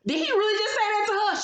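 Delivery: high, stunned gasp of a question; can't believe what you just heard; shocked disbelief. Retold or imitated. Reacting to the husband's insult, high shocked disbelief. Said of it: "And I was like".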